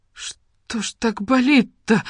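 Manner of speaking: slow, high pained moan; drawn-out plaintive moan. A narrator's reading. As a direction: groan it out, hurting. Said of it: "простонала Вася".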